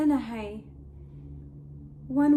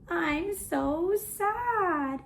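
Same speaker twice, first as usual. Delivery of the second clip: very slow, higher-pitched mock pout; hammed-up sorrow, stretching the words; sad; 4 semitones higher, much slower than usual. Shown as a demonstration. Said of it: "show me your sad face".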